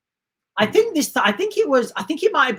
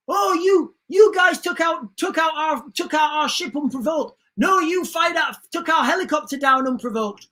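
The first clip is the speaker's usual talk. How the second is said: high indignant shout that falls back down; angry accusation; 6 semitones higher than usual. Retold or imitated. Russians and Germans blaming each other for the unprovoked attack, indignant shout. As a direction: shout the blame across at the other side.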